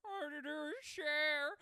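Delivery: loud, high, drawn-out diva impersonation. Acted. Showing off the resident impersonation, big diva belt. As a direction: belt it slowly in a pop diva's voice. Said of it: "the resident [name] impersonator".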